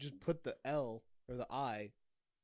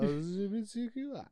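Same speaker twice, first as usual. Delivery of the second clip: letters squashed into one quick word, higher; run together as one word; 7 semitones higher than usual. Shown as a demonstration. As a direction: blur the letters into a single word. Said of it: "I made that, like, one letter long".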